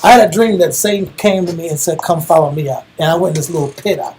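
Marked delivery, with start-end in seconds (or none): none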